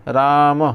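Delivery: "राम" said as a drawn-out "raa-mah"; visarga as h. Shown as a demonstration. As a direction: end "राम" on a breath of h after the m. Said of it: "विसर्ग का उच्चारण ह के समान होता है".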